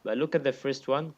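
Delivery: ordinary talk, nothing marked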